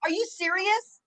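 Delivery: high, loud, drawn-out gasp of disbelief; stunned, pitch shooting way up; shocked disbelief. Real, not acted. Host reacting to guest born in ninety-nine, shocked and loud.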